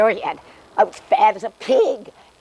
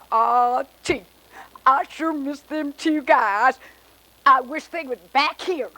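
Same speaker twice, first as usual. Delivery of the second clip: slow, higher Texan drawl; 6 semitones higher than usual. Acted. Texan's wish, joke punchline.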